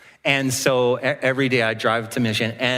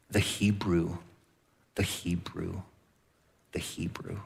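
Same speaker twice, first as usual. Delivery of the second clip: each "the Hebrew" softer until only breath; echoing fade into silence; fading to a whisper; 8 semitones lower, 11 dB quieter than usual. Acted. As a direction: let the words die away to a whisper. Repeated phrase fading out.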